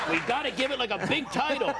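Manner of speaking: a hint of a pushy producer; impatient producer. Some producer hurrying the film along, lightly voiced. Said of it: "There's, like, some producer".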